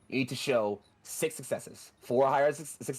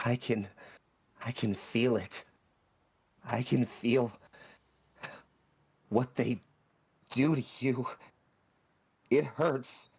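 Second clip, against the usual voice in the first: pained creature; 3 semitones higher than usual. Acted. Doraemon voice, pained.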